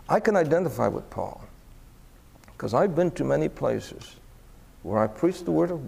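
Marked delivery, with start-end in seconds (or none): none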